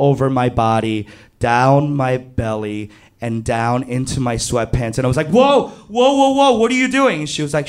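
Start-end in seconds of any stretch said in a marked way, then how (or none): 5.3-7.2 high, rapid panicked whoas; alarmed protest. Retold or imitated. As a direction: blurt a startled objection, pitched up and hurried. Alarmed protest in retold bed scene.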